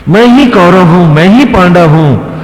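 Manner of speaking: high, loud acted proclamation of godhood; divine proclamation. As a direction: declare it forcefully as the Lord. भगवान की दिव्य घोषणा. (भगवान की ऊँचे स्वर में घोषणा)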